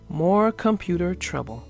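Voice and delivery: usual speaking voice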